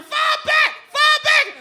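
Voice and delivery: very high loud yell; friends screaming. Retold or imitated. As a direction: shout it after her downhill. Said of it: "my friends are screaming".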